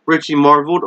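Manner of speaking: usual speaking voice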